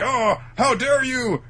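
high, loud, drawn-out mock outrage; outraged. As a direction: protest in hammed-up fury. Acting Gladius's outrage.

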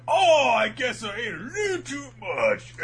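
high mock groan; squeaky put-on Komatsu; hammed-up high cartoon voice. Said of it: "he's like".

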